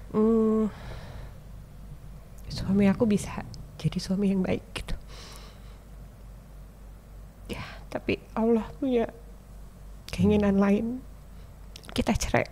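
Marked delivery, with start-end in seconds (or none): 2.5-12.5 soft whisper; quietly telling how the marriage ended; hushed. Real, not acted. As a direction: barely voice it, emotional. Recalling prayer and divorce.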